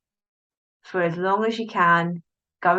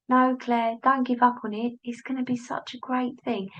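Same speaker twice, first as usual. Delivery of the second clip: higher little cheerleading voice; changed inner voice; 5 semitones higher, 4 dB quieter than usual. Acted. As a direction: plead encouragingly in a small, high voice. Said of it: "Well, I've changed the voice".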